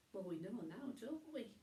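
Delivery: plain reading voice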